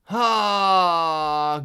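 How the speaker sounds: loud, long, high "ah"; drawn-out exclamation. Real, not acted. Streamer reacts to a chat message, loud drawn-out exclamation. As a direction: open up on one long, loud cry.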